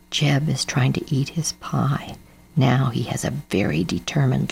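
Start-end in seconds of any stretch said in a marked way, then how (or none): none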